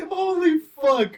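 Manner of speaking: laughing through tears; cry-laughing in shock. Real, not acted. Reacting to burning nasal spray.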